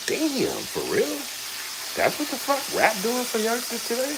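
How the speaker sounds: high, stunned voice at what he hears; dad hearing his son's rap, high and astonished; stunned disbelief. Retold or imitated. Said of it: "but it's like".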